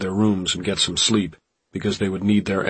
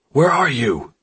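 high, loud demand; agitated, raised voice; yelled; 7 semitones higher, 6 dB louder than usual. A narrator's reading. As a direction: yell it down the phone. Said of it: "Jimmy yelled".

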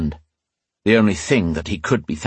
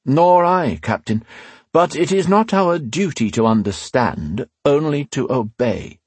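dutiful inquisitor; 7 semitones higher than usual. A narrator's reading. Leader answers captain.

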